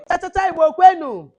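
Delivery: high, loud crowd call; call-and-response shout; rallying call. Real, not acted. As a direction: chant it out to rouse the group. Rallying call, audience answers Yeah.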